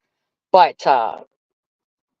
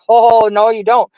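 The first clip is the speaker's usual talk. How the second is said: louder, higher mock outrage at the driver; retold indignant shout; 6 semitones higher, 4 dB louder, slower than usual. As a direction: call after him, half-yelling. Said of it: "I was like".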